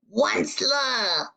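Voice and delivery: slow, drawn-out croaked call of the name; croaking cry. A narrator's reading. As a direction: croak the name out like a sick old creature. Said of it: "he cried with a cruffless croak".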